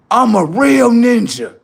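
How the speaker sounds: high, loud, drawn-out proclamation; mock boastful shout. Comic mocking a man's claim to be real, boastful shout. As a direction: belt out the boast with stretched words.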